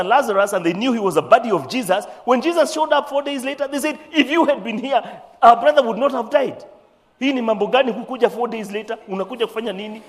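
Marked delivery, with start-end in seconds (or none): 4.1-6.6 mock reproach. Sisters reproach Jesus over Lazarus. 7.2-10.0 quick, higher Swahili rebuke to a late friend; indignant reproach. Acted. Sisters scolding Jesus for arriving late, indignant and quick. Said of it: "That's what they were really saying".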